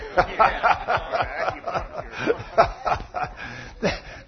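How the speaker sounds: long breathy belly chuckle; laugh. Real, not acted. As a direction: laugh helplessly at your own punchline. Amused by the chiropractor punchline.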